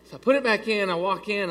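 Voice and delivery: ordinary delivery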